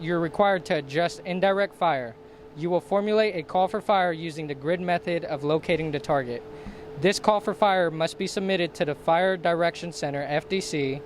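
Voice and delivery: ordinary delivery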